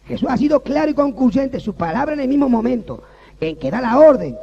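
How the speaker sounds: loud, high preacher's call; fervent preaching. Acted. Pastor preaching to the church, loud and fervent. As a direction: preach it out to the congregation.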